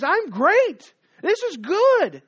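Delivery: high, loud and slow, puffed up with pride; triumphant gloating boast. Acted. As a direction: crow about how great you are. Haman boasting loudly.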